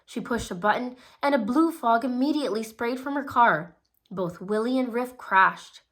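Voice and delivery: usual speaking voice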